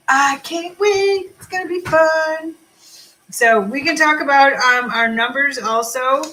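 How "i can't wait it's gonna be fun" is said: squealed high and drawn out, excited; to her viewers, a high slow excited squeal. Shown as a demonstration. High excited squeal, slow.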